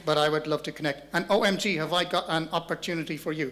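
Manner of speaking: wooden phone pitch. Acted. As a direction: sell it with exaggerated stiffness. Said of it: "people are not that wooden when they get on the phone".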